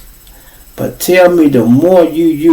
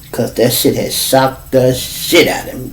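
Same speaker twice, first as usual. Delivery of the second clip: ordinary delivery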